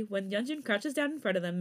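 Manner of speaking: ordinary delivery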